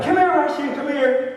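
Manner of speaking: high, urgent call after someone. Retold or imitated. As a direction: imitate a man running over to stop someone. Malcolm runs after him, calling.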